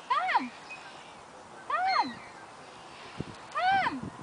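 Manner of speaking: high soft come call; coaxing call. Real, not acted. Calling bird, rising each time.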